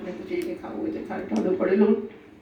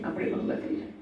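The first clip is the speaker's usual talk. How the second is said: mock whisper; 8 dB quieter than usual. Mocking the bribe-takers' logic.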